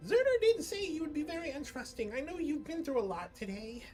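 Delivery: high, quick fairy chatter; pixie voice. Acted. GM voicing pixie.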